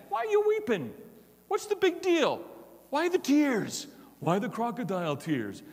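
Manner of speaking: mock the clueless husband brushing off tears; husband to crying wife, mock dismissive high voice; insensitive mock husband. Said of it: "question you want to lead with when your wife is crying".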